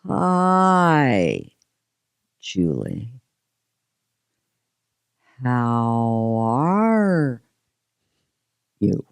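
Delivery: imitating a very slow drawn-out greeting; slow talker. Guest mimicking a slow-talking family member, drawn out. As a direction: drag every word out with long pauses. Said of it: "my family member talks like this".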